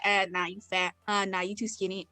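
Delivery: low, soft dismissive imitation; mock body shamer. Imitating someone who judges bodies, dismissive.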